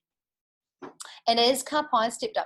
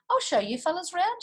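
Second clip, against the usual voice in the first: higher, friendly guide; lightly voiced Pippi; 10 semitones higher than usual. A narrator's reading. Pippi greets the visitors, lightly voiced. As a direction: welcome them like a host. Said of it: "she said".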